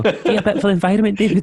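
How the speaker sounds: high, loud mock-pleading voice; Gary Neville. Campaigner urging David to be green, high comic voice. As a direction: nag him in a squeaky caricature. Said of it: "Gary Neville was out".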